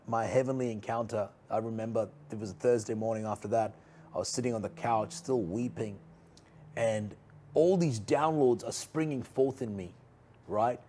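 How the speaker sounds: ordinary delivery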